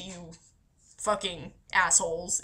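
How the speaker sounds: ordinary talk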